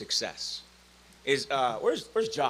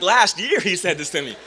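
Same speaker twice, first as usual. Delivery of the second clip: usual speaking voice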